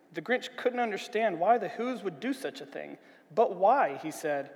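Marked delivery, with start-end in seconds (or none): 3.3-4.0 louder, puzzled question; bewildered. A narrator's reading. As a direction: ask it in bewilderment. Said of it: "utterly bewildered".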